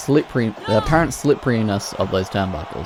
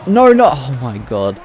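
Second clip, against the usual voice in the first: shocked; 3 semitones higher, 6 dB louder than usual. Real, not acted. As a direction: gasp it out as the move lands. Shocked by outside move.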